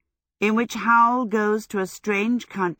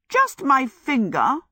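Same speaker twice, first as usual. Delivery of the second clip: raised, slow and dignified; a touch of lofty dignity; 7 semitones higher, 4 dB louder, slower than usual. A narrator's reading. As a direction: answer with wounded pride, taking your time. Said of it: "Sophie said with dignity".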